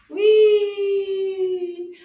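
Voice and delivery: a high, very loud "Oui!"; excited cheer. Real, not acted. Teacher cheers the class after the fast round, excited. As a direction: shout it in triumph.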